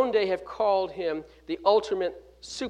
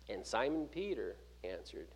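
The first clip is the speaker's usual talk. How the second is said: ordinary talk, nothing marked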